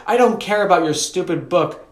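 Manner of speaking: put-on high, cross child's outburst; hollered. A narrator's reading. Sister interrupting brother's talk of the book, hollered. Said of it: "Ellie hollered".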